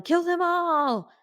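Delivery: high, drawn-out imitated yell; mock battle-cry yell.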